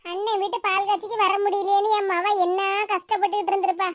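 normal talking voice

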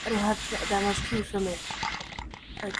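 ordinary delivery

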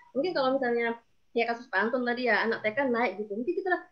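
ordinary delivery

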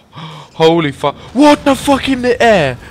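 loud, high shocked outburst; shocked yell. Real, not acted. Gamer yelling in shock at a flying car, loud and high. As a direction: blurt it out in disbelief.

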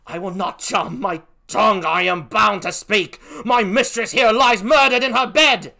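rising to a loud accusation; defiant Emilia. Acted. Emilia refuses to charm her tongue, increasingly loud and defiant. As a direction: build from firm refusal to outcry.

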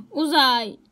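loud, high call across the house. Real, not acted. Calling out to her mother in the house, a loud high call. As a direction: shout to someone in another room.

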